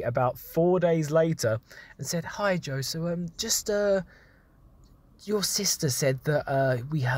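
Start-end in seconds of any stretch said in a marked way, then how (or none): 2.3-7.1 lightly voiced hesitant mum; mum's awkward request. Mum to son about keeping it down, tentatively imitated. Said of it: "my mum then came to me".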